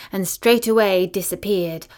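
plain reading voice

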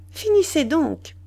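wife fending off her husband, mildly protesting; pushing him off. A narrator's reading. She pushes him away.

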